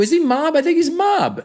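high, conspiratorial mock worry; comic suspicion. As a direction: squeak it like a juicy suspicion. Comic bit, suspicious aside.

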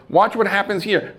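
a man dying to show off his stain powder; pushy carpet cleaner demanding attention, high and loud; eager salesman voice. Retold or imitated.

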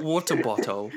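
American trying British "water bottle"; imitated British accent. Shown as a demonstration. Host echoing the guest's word, imitated British accent. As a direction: copy the English way of saying "water bottle".

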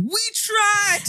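high, exasperated cry. Retold or imitated. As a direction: throw it up high, fed up. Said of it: "listening to this going".

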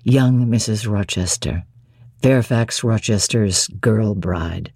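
deep, low, doting voice; deep voiced master, softly adoring; Rochester, fond. A narrator's reading. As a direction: croon it low to his bride.

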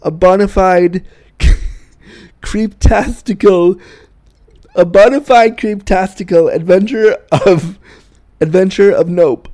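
giggling over own notes, slowed; amused reading of own joke; reading notes through laughter. Real, not acted. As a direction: read it aloud while cracking up. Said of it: "and I wrote down here".

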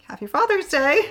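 spring the happy news on him; excited reveal. Retold or imitated. Announcing pregnancy to husband.

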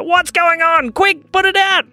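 high, loud alarmed cry; frantic call to put it out; panicked shout. Acted. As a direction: panic as sparks fly everywhere. Panic as wreath sprays sparks.

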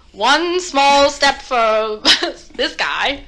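slow, grand astronaut announcement; mock moon landing quote. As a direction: deliver it like a historic broadcast.

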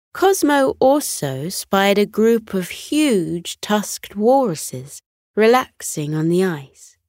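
ordinary delivery

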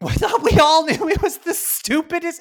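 high, loud incredulous rant; exasperated outburst. Real, not acted. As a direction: burst out in laughing disbelief. Exasperated, laughing at the bad accent.

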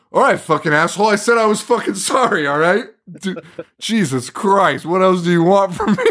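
hammed-up aggrieved griping, breaking into laughter; indignant comeback. Podcaster voicing the guy snapping back at Claire's dad, mock indignant.

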